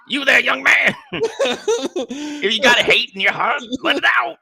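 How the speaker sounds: high, loud, hollering caricature; hammed-up old man. Old man calling out a young man, hammed-up shouting impression. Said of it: "Bill Cosby on the verge of Uncle Ruckus".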